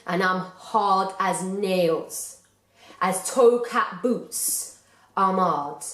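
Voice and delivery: put-on slow, swaggering rude-boy school bully; Oggy Moxon. School bully boasts to the audience how hard he is, slow rude-boy swagger. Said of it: "made Oggy Moxon a bit of a rude boy".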